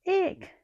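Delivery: high loud squeak of fright; bear's squeal. A narrator's reading. The bear scared by the giant bee, squealing. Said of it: "squealed the bear".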